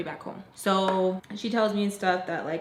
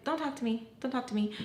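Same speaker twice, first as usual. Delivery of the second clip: high, softer imitation of a huffy friend; quiet mock huff, pitched up; retold brush-off; 4 semitones higher, 6 dB quieter, faster than usual. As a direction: act out shutting someone out. Friend refusing to speak, acted out.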